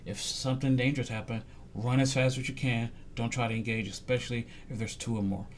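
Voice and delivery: low, soft imitation of a parent's advice; retold fatherly warning. Father warning his son about danger, quiet and low. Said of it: "to say, like".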